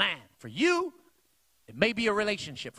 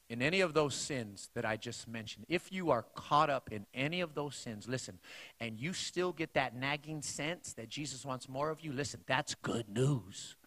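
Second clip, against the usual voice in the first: much lower and softer, tender; soft appeal; 6 semitones lower, 7 dB quieter than usual. Real, not acted. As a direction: lean in and speak gently. Preacher softens to gentle appeal.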